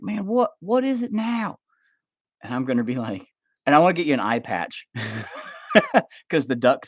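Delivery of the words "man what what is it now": high, slowed, exasperated friend voice; high put-on whiny voice; annoyed friend. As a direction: play the friend fed up. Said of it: "And you're gonna be like".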